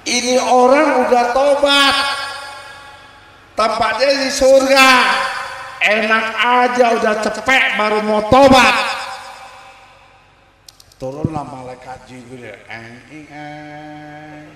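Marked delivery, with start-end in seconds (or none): 0.1-9.0 high, loud indignant protest; heated argument. Acted. Angels argue over the soul. 12.7-14.5 very soft humming whoosh; descending hum. Acted. Angel Jibril descends.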